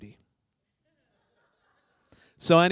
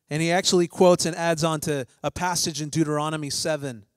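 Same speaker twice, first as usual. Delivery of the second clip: usual speaking voice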